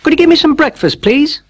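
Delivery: put-on high, fast, hopeful boy; polite boy. A narrator's reading. Jack asking the giant's wife for breakfast, high and hopeful.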